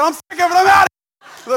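high, full-volume mock shout; mock outburst. Pastor mimicking a congregant quitting in a mock shout. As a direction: imitate a fed-up churchgoer yelling at the top of the voice. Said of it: "Miss Becky said".

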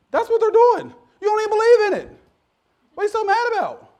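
high, very loud preacher's outburst; exasperated shout. Acted. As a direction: yell it in sheer exasperation. Exasperated outburst at angry unbelievers.

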